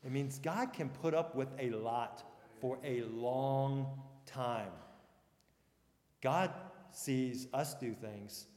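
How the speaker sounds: ordinary talk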